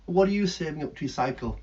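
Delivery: normal talking voice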